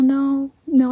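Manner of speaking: ordinary talk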